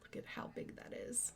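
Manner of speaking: hushed whisper. Real, not acted. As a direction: breathe it out unvoiced. Excited aside showing fabric.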